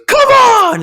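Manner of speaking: huge high shout; imitated shout. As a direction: yell it out in triumph. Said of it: "Zendaya goes".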